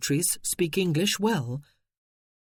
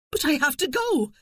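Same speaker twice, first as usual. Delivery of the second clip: desperate; 10 semitones higher than usual. Acted. Wife insisting desperately.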